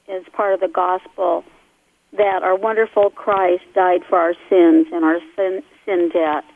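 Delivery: normal talking voice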